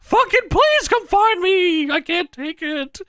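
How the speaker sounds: very high, slow mock plea; hammed-up wail of a fed-up captive; Paul Bearer. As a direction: drag out the pleading, high and whiny. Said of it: "and Paul Bearer says".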